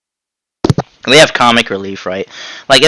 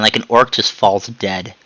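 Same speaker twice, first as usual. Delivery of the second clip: ordinary talk, nothing marked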